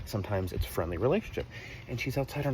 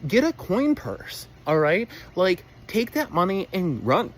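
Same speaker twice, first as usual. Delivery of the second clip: urging; 7 semitones higher, 4 dB louder, slower than usual. Real, not acted. Urging parents to cash in.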